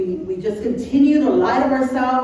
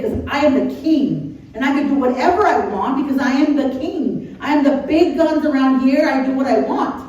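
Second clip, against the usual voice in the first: emphatic, boastful declaration, raised pitch; self-important king; 4 semitones higher, 4 dB louder than usual. Acted. Preacher voicing David justifying his sin, self-important king. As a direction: throw your weight around, mock royal arrogance. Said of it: "pues, él era el rey".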